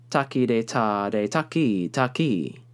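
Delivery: "taki de ta de taki" with the long second syllable; taki rhythm. Shown as a demonstration. Teacher chanting rhythm syllables to students, short-long taki. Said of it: "So, like".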